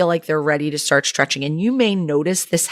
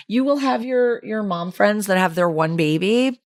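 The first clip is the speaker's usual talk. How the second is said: ordinary delivery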